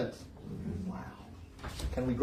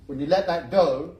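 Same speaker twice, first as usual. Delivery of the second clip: ordinary talk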